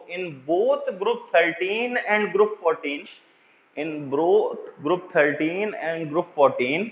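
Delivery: very slow, word by word, with long pauses; spaced-out dictation read to a class. Acted. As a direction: dictate it so students can write each word. Dictating the correct statement.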